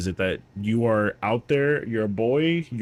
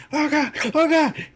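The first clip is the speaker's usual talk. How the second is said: much higher, panicked mock wail; panicked soldiers. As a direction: imitate terrified soldiers under fire. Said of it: "They're like".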